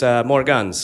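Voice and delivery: usual speaking voice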